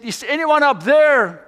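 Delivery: loud, high, drawn-out shout upward; yelled call for help. Retold or imitated. Fallen hiker calls for anyone up there, yelling. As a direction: call up into the dark, slowly. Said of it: "he yelled".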